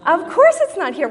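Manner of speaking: loud, high exasperated retort; exasperated parent. Retold or imitated. Mother to son back from the mailbox, exasperated.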